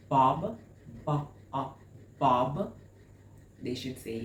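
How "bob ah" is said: "Bob" broken up to isolate "ah"; sounded-out vowel. Shown as a demonstration. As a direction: pull the "ah" out of "Bob". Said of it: "Do you hear the ah sound".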